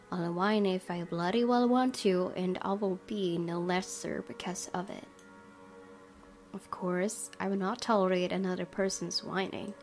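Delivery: ordinary delivery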